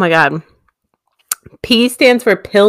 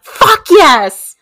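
triumphant shout; 12 semitones higher, much slower than usual. Real, not acted. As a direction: cheer like you just won. Celebrating a correct answer.

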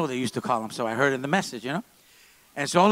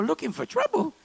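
much higher, incredulous echo of the accusation; shocked disbelief; 13 semitones higher than usual. Retold or imitated. Pastor answering Pastor Joe's accusation in shocked disbelief. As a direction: toss the word back in shocked surprise.

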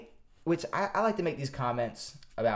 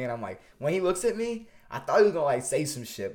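usual speaking voice

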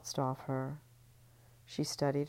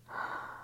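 faked gasp; 9 dB quieter than usual. [name] feigns surprise.

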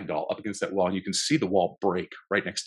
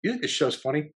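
quizzing co-star; 7 semitones higher than usual. Retold or imitated. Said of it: "he goes".

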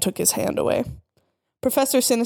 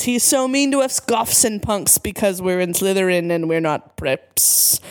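ordinary delivery

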